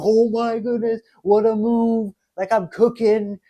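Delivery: high, thrilled gush at his own great move; excited over a chess move. Retold or imitated. Chess player cheering his own good move, high and excited. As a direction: imitate yourself hyped after a brilliant chess move. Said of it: "I'm, like, jumping all over the place".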